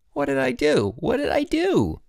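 very high, distressed squeal; panicked. A narrator's reading. Perry panics at the mess.

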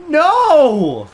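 sharp frightened yell; scared shout. Real, not acted. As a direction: cry out in fright.

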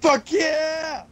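loud, high victory yell; triumphant shout. Real, not acted. Gamer celebrating a kill, triumphant shout. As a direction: cheer it out. Cheering a win in game.

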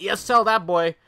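loud, high mock yell; mock group verdict. Imitating school staff sending a kid to E S L, mock yell. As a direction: bellow it like a crowd passing judgment. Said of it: "They were all like".

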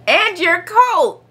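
high, slow call; mother crying out. A narrator's reading. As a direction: cry it out, stretching each word. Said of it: "cried his mother".

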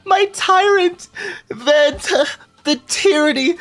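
high, drawn-out theatrical wail; hammed-up high adoring cry; mock dramatic wail. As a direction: camp it up, high and slow like a swooning fan. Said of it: "He's just like".

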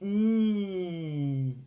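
"Mm" as a long hummed n; isolated end sound. Shown as a demonstration. Teacher gives thin's last sound, "Mm" as a held nnn. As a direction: sustain "Mm" as one nasal consonant, like nnn. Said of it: "That's right".

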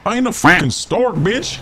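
angry. Acted. Seagull snapping at a joke.

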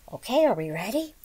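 plain reading voice

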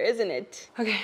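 ordinary delivery

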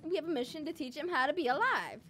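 usual speaking voice

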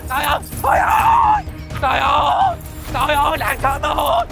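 theatrical wail of grievance; wailing for help. Acted. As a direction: call out long like a wronged woman. Wails for husband to help.

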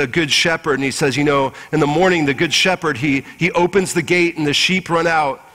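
usual speaking voice

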